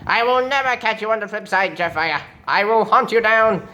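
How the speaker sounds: high, ranting threat; hammed-up robot villain fury; villain's vow of revenge. Starscream threatening the traitor Jetfire, high ranting fury.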